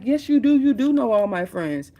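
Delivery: high, loud insistent wife; wife's insistent retort. Retold or imitated. Wife snapping back at her husband, high and insistent. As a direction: fire it back high and sure.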